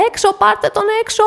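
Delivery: high, loud furious command; θυμωμένη, δυνατή διαταγή; angry order. Acted. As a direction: yell at them to take the dog out. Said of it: "έλεγε θυμωμένα".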